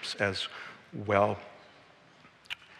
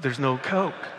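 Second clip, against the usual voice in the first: mild whine; 3 semitones higher, slower than usual. Retold or imitated. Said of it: "I whined a little bit".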